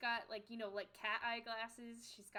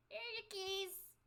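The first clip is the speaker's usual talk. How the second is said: lightly voiced old lady, a touch faster; a hint of a brisk old innkeeper; man doing a woman's voice; 8 semitones higher than usual.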